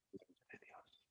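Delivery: breathy whispered aside. Acted. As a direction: drop to a whisper for the last words. Hushed close to the line.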